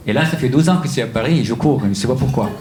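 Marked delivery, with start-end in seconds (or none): none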